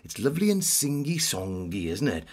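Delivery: sing-song Welsh lilt; Welsh accent. Acted. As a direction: play up a singsong Welsh accent. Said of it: "what about Welsh?".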